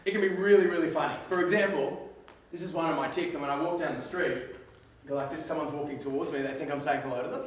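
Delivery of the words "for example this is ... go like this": ordinary delivery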